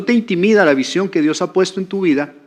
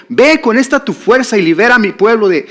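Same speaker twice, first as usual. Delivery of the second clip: loud, higher-pitched order; commanding; 7 semitones higher, 6 dB louder than usual. Acted. The Lord commissioning Gideon to free his people, commanding. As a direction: send him off with a forceful command.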